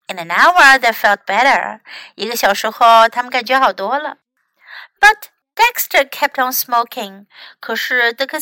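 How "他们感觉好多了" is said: ordinary delivery, nothing marked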